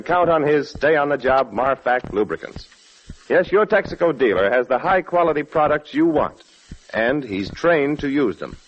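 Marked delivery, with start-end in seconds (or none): none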